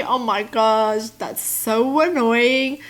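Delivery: normal talking voice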